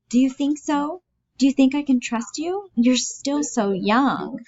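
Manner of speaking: high, pleading aunt voice; anxious, childlike Bella. Acted.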